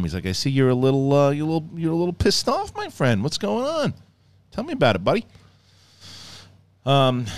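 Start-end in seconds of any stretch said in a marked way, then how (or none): 0.3-5.2 high, lilting mock-calm impression; Emperor. Master checking on his pissed-off friend, mock calm. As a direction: coax him in a raised, chummy singsong. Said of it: "he's more calm".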